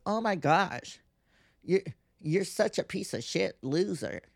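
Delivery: high, sneering mock voice of a critic; a put-on snobbish scoff; mocking scorn. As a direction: sneer it like a snooty judge.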